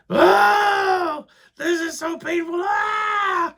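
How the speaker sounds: high, hammed-up pained wail; mock wailing wife. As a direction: overdo her cries of pain. Wife's pain retold, mocking.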